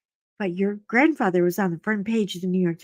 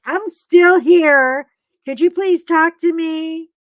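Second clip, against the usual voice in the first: slow, louder, pleading pet voice; dog's plea; 7 semitones higher, 8 dB louder, slower than usual. Pretend. The dog begging its owner to talk to it, pleading. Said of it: "Mutt Nuts is like".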